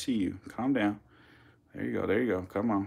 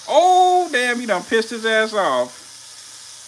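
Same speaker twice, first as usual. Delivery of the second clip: high, loud, slow shocked squeal; 12 semitones higher, 7 dB louder, much slower than usual. Real, not acted. Reacting to an angry viewer, a high shocked squeal. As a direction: yelp in high disbelief.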